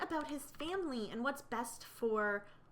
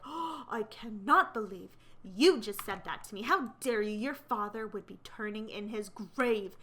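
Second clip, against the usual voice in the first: gasping, louder mock indignation; outraged retort; 6 dB louder than usual. Mother answering her son's insult, acted outrage. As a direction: act the scandalized mother, punching it out.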